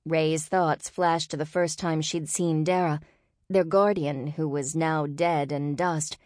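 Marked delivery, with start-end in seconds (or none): none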